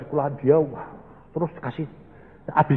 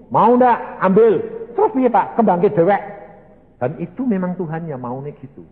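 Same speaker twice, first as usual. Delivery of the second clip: ordinary talk, nothing marked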